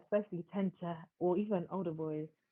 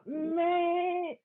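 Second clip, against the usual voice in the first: drawn out, high and louder, waving it away; slow, scornful shrug of a word; dismissive meh; 9 semitones higher, 4 dB louder than usual. Real, not acted. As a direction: stretch the meh, unimpressed. Dismissing younger partners.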